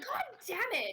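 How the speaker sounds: cursing, pitched high; high frustrated curse. Acted. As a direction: curse while hiking up a long dress. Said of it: "She's like".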